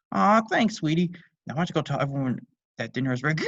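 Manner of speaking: high loud fatherly thanks; cheery dad. Acted. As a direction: beam at your daughter.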